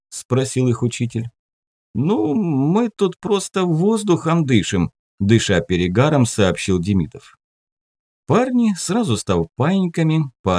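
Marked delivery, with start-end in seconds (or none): 1.9-4.9 slow high innocent-acting voice; drunk teen. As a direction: play innocent, a bit tipsy. Drunk teen excusing himself.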